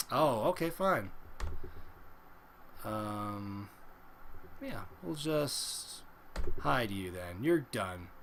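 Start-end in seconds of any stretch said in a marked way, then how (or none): 2.8-8.1 quiet dismissal. Real, not acted. Muting a chatter, low and slow.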